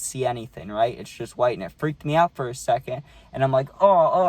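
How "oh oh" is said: sudden high, loud startled cry. Retold or imitated. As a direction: jolt awake in a panic. Startled waking in blinding sun.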